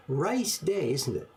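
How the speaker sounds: "Rice day" sung out high, then "isn't it" plain; stress on Rice. Shown as a demonstration. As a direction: hit "Rice" to land the pun. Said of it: "you change one syllable and you say".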